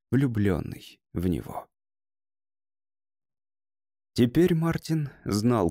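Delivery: usual speaking voice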